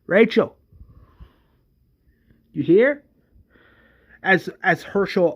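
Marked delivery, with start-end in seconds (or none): 0.1-3.0 higher, louder searching call; questioning shout. Acted. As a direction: call for someone who may be nearby. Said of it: "screaming for Rachel".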